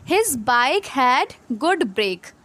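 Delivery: very slow, word by word; slow read-aloud. Shown as a demonstration. Teacher reading the example sentence, very slowly. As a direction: drag out each word for the class. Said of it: "तो यहाँ पे क्या लिखा है?".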